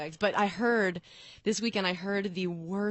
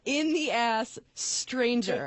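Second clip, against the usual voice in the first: incredulous emphasis; 5 semitones higher, much slower than usual. Real, not acted. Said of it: "retelling shocking story".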